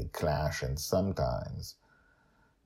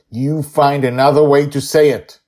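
raised, insistent parental rebuke; stern father scolding; 10 semitones higher, 14 dB louder than usual. Acted. Father scolding his sword-drawing daughter, loud and stern. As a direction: lay down the law to her, loud and firm.

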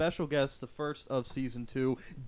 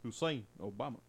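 Obama impression; 3 semitones lower, 5 dB quieter than usual. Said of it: "Damn, that's a really good one".

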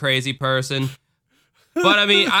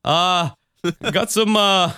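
lightly higher, friendly mock Canadian accent; 5 semitones higher than usual. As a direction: play a cheerful Canadian neighbour. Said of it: "the most Midwestern Canadian".

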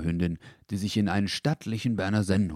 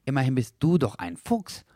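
higher, pedantic little dog voice; fussy dachshund; 6 semitones higher, 4 dB louder than usual. A narrator's reading. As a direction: explain it primly, a bit too carefully. Dackel voiced in story. (pedantischer Dackel, höher)

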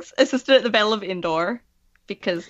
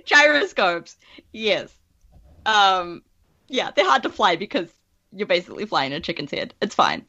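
ordinary talk, nothing marked

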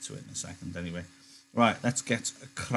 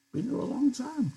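put-on high old-man voice for Candy, a bit embarrassed; 3 dB quieter than usual. Old Candy, embarrassed, to Crooks, high old-man voice. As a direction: lift into a higher old man's voice, shy.

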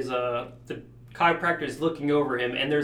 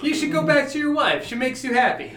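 high, lightly voiced kindly Italian man; a hint of a sweet middle-aged Italian; nice Italian man; 11 semitones higher, 5 dB louder than usual. Friend imitating the movie chiropractor, light Italian voice. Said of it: "nice middle-aged Italian man".

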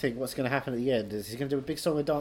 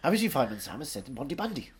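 lilting Welsh accent at an easy pace. Shown as a demonstration. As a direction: repeat it in singsong Welsh. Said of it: "Say it again".

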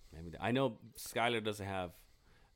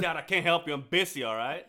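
higher, louder put-on impatience; mock brush-off; 11 semitones higher, 9 dB louder than usual. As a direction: brush your dad off playfully. Mock son brushing off dad.

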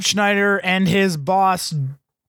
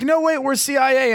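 mock panicked plea; 7 semitones higher than usual. CIA men pleading at the edge.

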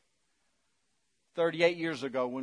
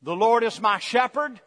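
usual speaking voice

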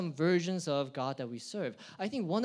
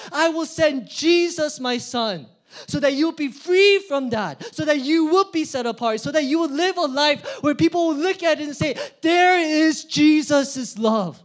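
passionate God; 8 semitones higher, 9 dB louder than usual. Acted. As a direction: declare it with fierce zeal. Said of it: "he looked at that and he said".

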